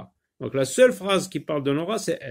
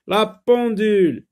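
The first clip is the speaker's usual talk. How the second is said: higher, louder announced word; dictation style; 6 semitones higher, 5 dB louder than usual. Shown as a demonstration. Teacher giving the next word to children, dictation style. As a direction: call the word out clearly for pupils.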